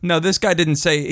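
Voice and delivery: normal talking voice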